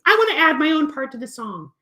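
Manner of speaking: high eager kitty; puppet cat voice. Acted. Cat puppet asks to join the song, high and eager. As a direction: ask brightly as the cat. Fluffy requests.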